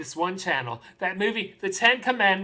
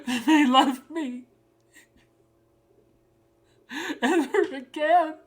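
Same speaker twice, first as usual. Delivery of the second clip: higher voice breaking into crying; tearful; 7 semitones higher than usual. Acted. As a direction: deliver it through tears, in broken phrases. Tearful dramatic monologue, crying.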